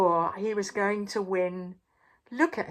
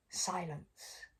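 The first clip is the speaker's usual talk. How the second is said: plain reading voice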